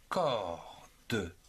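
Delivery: "corde" broken into a long "cor" and a separate "de"; "corde" in two detached beats, final e sounded; split into syllables. Shown as a demonstration. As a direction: say "corde" as cor... de, pausing between. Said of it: "deux syllabes".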